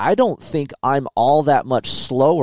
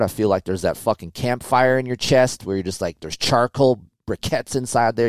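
ordinary talk

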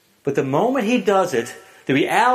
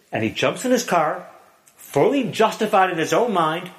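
ordinary talk

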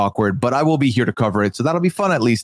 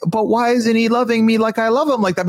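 high-pitched imitation of her protest; hammed-up high pleading; mock complaint; 10 semitones higher than usual. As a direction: mimic a wounded complaint, pitched up. Mocking her complaint, acted quote.

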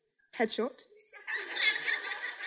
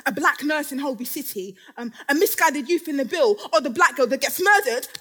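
normal talking voice

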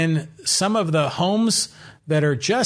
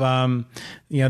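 normal talking voice

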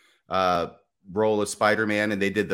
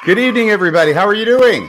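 loud host greeting; 9 semitones higher, 11 dB louder, faster than usual. Acted. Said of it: "I couldn't hear you".

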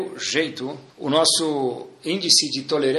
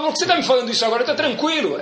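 mock irritated denial; 8 semitones higher, faster than usual. Angry person voiced denying.

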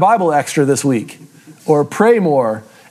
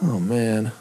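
regretful groan; 6 semitones lower, 11 dB quieter, much slower than usual. Retold or imitated. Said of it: "you just go".

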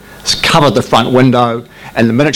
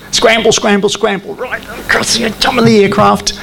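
higher, urgent call of scramble scramble; 10 semitones higher than usual. Retold or imitated. Pilot retelling the scramble alarm, higher urgent call. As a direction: raise the alarm to run for the jets.

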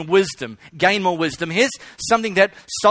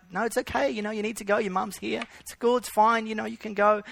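softer, higher gentle coaxing; soothing teacher; 3 semitones higher, 6 dB quieter than usual. Retold or imitated. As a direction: coax the child softly to go to his mum. Teacher soothing the crying boy.

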